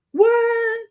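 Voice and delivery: high squeaky mock what; Rachel's what. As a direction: chirp it like a surprised Rachel. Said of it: "That's what Rachel say sometimes".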